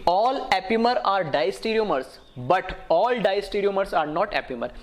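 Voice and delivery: slow, deliberate teaching emphasis; measured, drawn-out dictation of a rule; slow rule statement. Real, not acted. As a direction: spell out the rule word by word. Stating a rule slowly for students.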